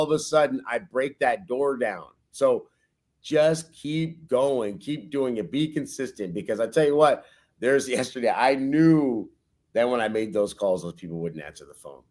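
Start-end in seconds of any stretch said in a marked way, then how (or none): none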